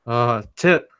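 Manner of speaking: awkward fumbling. Retold or imitated. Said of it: "He's like".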